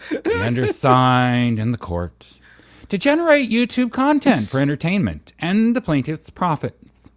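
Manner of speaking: melodramatic reading, leaping high on key phrases; Bubbles. Pretend. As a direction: camp up the legal text, stretching words suggestively. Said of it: "read this in your Bubbles voice".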